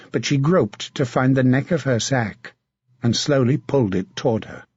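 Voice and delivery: plain reading voice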